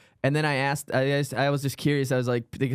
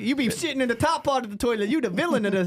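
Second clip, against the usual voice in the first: mock comic voice; 9 semitones higher than usual. Mocking the comic together.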